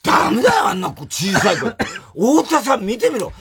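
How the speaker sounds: high-pitched mock outburst; retold angry scolding. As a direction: act out his complaint, voice jumping up. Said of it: "カップルに怒ってんだよ". (声を張り上げて怒る真似)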